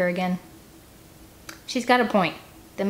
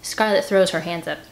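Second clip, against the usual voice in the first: ordinary delivery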